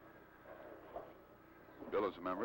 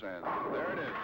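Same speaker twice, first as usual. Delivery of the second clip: louder, rising call as the pins fall; excited strike call; 2 semitones higher, 5 dB louder than usual. Real, not acted. Announcer calls Dick Hoover's strike, excited. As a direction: cheer the strike out.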